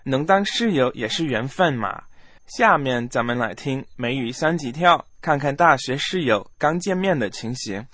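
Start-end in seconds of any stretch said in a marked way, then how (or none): none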